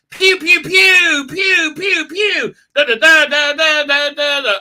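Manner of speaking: very high, loud mouthed pew-pew blasts; laser and gunfire noises. Retold or imitated. Fan recapping the chase: high mouthed laser fire. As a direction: make childlike laser-gun noises. Imitating a space battle.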